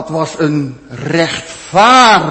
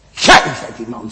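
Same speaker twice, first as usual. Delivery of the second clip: Nathan accusing; 6 semitones lower than usual. Acted. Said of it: "zegt Nathan".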